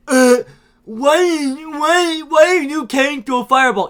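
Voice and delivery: high, loud mock complaint; mock a baffled moviegoer loudly; exaggerated, high-pitched dumb-question voice; whining moviegoer. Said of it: "you're like".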